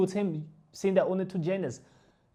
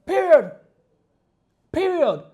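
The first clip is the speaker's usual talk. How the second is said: loud, final, falling; emphatic shout; 6 semitones higher, 9 dB louder, slower than usual. Real, not acted. Angry vlogger ends the argument with viewers, loud. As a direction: slam the point shut. Ranting, closing his argument.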